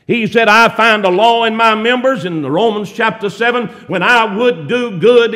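loud, driving Southern sermon; fiery preaching. Acted. Preacher exhorting the church, in full sermon voice. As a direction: preach it out to the congregation.

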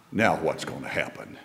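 hushed wondering. Retold or imitated. Israelites' anxious thought at sea.

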